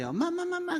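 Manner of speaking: put-on high-pitched child calling mom again and again; whiny child calling mom. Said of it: "애들하고 얘기할 때". (높은 목소리로 엄마를 조르는 아이)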